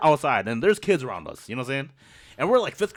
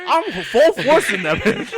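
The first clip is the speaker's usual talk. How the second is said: high, helpless laughing speech; real laughter; 4 dB louder, slower than usual. Real, not acted. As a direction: tell it while cracking up. Cracking up at his own story.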